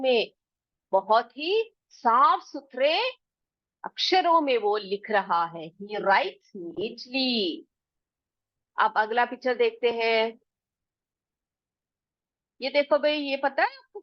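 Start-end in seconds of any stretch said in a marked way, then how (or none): 5.8-7.6 drawn out, word by word; teacher dictates slowly so pupils can write; very slow dictation. Shown as a demonstration. Teacher slowly dictates the sentence to the class. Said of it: "तो हम क्या लिखेंगे वहां पर?".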